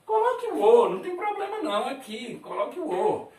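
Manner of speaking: high, light "coloque o O" with a laugh; reassuring. Shown as a demonstration. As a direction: reassure the class that either way is fine. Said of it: "de acordo com a região que fala tempo".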